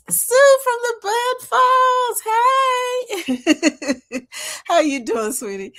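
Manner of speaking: loud, high call-out to a viewer; yelling greetings. Real, not acted. Greeting Sue from the Bud Files, loud and high. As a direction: call the name out across the room. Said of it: "it sounds like I'm yelling".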